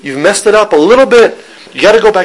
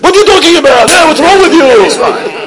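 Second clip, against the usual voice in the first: screaming Israeli GPS; 11 semitones higher, 4 dB louder than usual. Acted. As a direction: yell like an exasperated Israeli navigation system. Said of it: "be an Israeli G P S".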